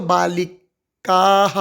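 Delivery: बालिकाः very slow, long "kaa" then "ha"; बालिकाः as "baa-li-kaaa-ha"; visarga after long vowel. Shown as a demonstration. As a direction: stretch the last vowel of बालिकाः before a final "ha". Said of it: "ह का उच्चारण करते हैं हम".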